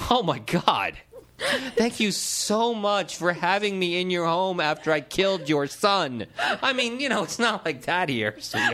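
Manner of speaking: high, hammed-up gushing; over-the-top gushing. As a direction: gush it like a melodrama. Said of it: "in some way that doesn't sound so much like a lost puppy".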